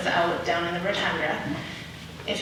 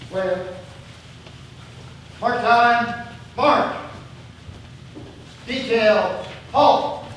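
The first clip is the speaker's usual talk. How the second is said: marching while talking; much slower than usual. Real, not acted. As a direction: bark each step call like a drill sergeant. Calling drill commands for color guard.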